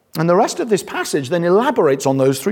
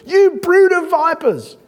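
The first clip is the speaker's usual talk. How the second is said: higher, slow, drawn-out accusing cry; mock prophet denunciation; 12 semitones higher, slower than usual. Preacher imitating John denouncing the crowd, slow hammed-up cry. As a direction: stretch it out like a fiery prophet denouncing. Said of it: "that's what John says".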